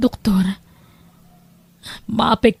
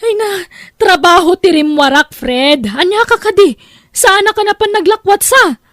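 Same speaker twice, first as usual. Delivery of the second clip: loud, high, defensive shouting; heated argument; 4 semitones higher, 3 dB louder than usual. Acted. Wife shouting back at her husband during a fight.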